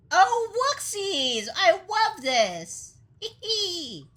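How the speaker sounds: high, slow, drawn-out squeal of glee; squeaky glee ending in a little giggle; cutesy delight. Acted. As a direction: gush in a tiny cartoon voice. Cute delight at finding loot.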